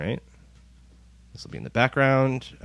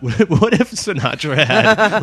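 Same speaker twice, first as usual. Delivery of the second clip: laughing; 9 semitones higher, 9 dB louder, slower than usual. Real, not acted. Joking about [name] doing post-rock.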